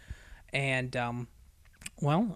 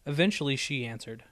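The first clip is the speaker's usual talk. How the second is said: plain reading voice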